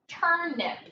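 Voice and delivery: usual speaking voice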